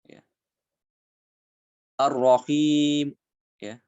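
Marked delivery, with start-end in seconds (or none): 2.0-3.2 Ar-Rahim slow, with only a faint trace of u after the m; raum ending. Shown as a demonstration. Teacher demonstrating raum on Ar-Rahim. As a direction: stretch Ar-Rahim, then a quick ghost of u. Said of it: "kurang dari satu harokat".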